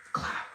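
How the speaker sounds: short mouth gunshot pop; imitated gunshot.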